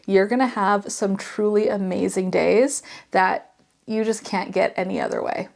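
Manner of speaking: ordinary talk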